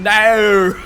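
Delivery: drawn-out, loud Australian "no"; exaggerated Australian vowel, as if many extra letters; mock Australian accent. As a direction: stretch "no" into a long Australian diphthong. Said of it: "the way Australians say no with".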